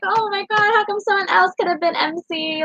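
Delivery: high, teary complaint; real tears creeping in; tearful. Real, not acted. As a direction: voice cracking on the edge of tears. Voice breaking while retelling.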